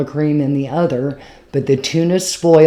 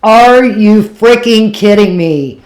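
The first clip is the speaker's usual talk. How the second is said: loud, slow, higher outraged cry; outraged disbelief; 7 semitones higher, 10 dB louder than usual. Retold or imitated. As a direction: blast it in total disbelief. Outraged at the drunk's insult.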